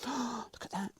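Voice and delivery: gasp, then a slow, soft, high look at that; awed gasp. Real, not acted. Showing the viewer his pie, awed soft gasp. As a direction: breathe it out in awe.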